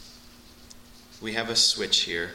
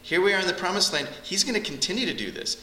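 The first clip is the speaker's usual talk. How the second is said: excited Moses; 8 semitones higher, faster than usual. Retold or imitated. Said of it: "And he's saying".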